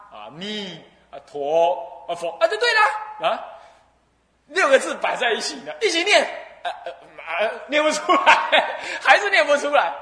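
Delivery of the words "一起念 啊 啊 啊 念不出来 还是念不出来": high, loud words through laughter; laughing. Real, not acted. Preacher laughing while telling the failed reading. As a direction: talk through a real laugh. Man failing to read, laughing.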